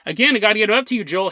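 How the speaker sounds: normal talking voice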